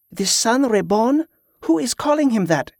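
high-pitched, incredulous question; demanding. A narrator's reading. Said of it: "she demanded".

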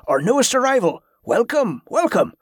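hearty welcome. Acted. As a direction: welcome the newcomer with enthusiasm. Host welcomes newcomer to group.

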